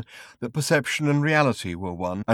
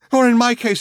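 much higher and louder; raving; 13 semitones higher, 10 dB louder than usual. Acted. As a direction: cry it out wildly. Raving man.

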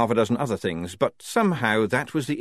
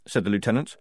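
usual speaking voice

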